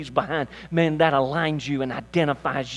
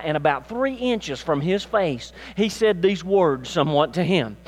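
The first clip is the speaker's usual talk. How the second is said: usual speaking voice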